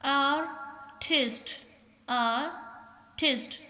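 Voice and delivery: "Artist" slowly as आर, pause, टिस्ट; split into syllables. Shown as a demonstration. Teacher counting two syllables, "artist" said in two parts. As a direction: break "artist" in two, each time. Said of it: "टू syllable".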